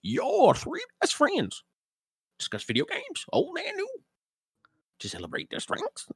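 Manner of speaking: put-on grizzled old prospector reading. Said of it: "my prospector voice".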